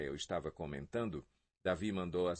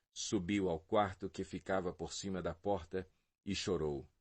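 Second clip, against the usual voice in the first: plain reading voice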